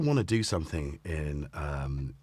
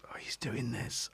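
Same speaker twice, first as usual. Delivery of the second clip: hushed aside about the cat; whisper; 10 dB quieter than usual. Real, not acted. Owner whispering about what his cat is doing. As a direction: whisper it, don't disturb him.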